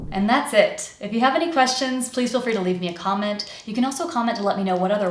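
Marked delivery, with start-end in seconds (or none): none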